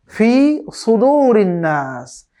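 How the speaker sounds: drawn-out melodic tajweed chant; slow Quran recitation. Shown as a demonstration. Teacher recites slowly for students to copy. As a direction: recite each syllable long and measured.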